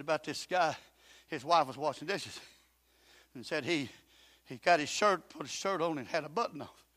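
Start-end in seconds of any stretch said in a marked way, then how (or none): none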